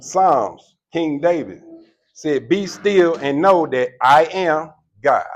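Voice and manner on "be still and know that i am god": slow, louder, weighty reading; solemn scripture. Retold or imitated. King David's psalm quoted to the crowd, slow and solemn. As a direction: let each word land like scripture.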